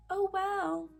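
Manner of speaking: high, breezy dismissive voice; dismissive shrug voice. Retold or imitated. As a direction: brush it off high and light. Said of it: "you're just like".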